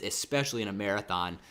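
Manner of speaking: ordinary delivery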